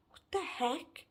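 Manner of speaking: stunned, hushed whisper; whispered disbelief. Retold or imitated. Thinking to self after housemate's outburst, whispered. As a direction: whisper it like a shocked thought. Retold stunned thought in silence.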